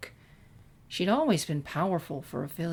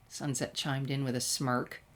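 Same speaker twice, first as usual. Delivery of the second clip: plain reading voice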